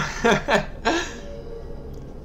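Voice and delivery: high breathy laugh; airy giggle. Real, not acted. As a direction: giggle between words. Laughing at chat joke.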